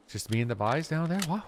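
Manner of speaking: lightly mocked Newfie accent with a rising "what?". Joking about how Newfies end sentences, light Newfie accent. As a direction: do a loose Newfie impression. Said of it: "I can't do a Newfie accent".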